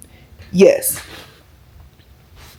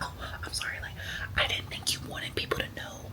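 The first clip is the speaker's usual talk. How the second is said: hushed secretive coworker whisper; whispered quote; 23 dB quieter than usual. Retold or imitated. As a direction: lean in and whisper like it's a secret. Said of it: "Why are we whispering?".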